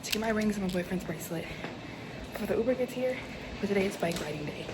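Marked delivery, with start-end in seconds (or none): 0.0-3.2 out of breath from the stairs; panting after running upstairs. Real, not acted. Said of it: "I'm literally out of breath".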